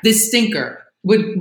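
ordinary talk

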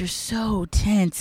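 slow soothing masseuse. Shown as a demonstration. Masseuse roleplay line.

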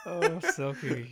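ordinary talk, nothing marked

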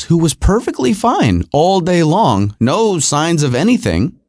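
ordinary talk, nothing marked

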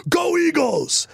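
high, drawn-out shout imitating mom; retold rallying cry, stretched out high; mom's Eagles cheer. Mom cheering into a packed elevator, imitated high shout. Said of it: "she goes".

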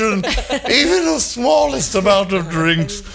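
put-on high prim voice of an old gentleman; posh gentleman voice. Friends ribbing about drinking, prissy gentleman voice. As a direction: play a fussy aging gentleman. Jokey genteel self-description.

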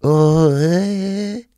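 high, slow, put-on voice of a shifty stranger; coronavirus as a sneaky foreign stranger. Comedian voicing the coronavirus as a person, high shifty put-on voice. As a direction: play a shady guy pretending not to speak English. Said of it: "it would probably talk like this".

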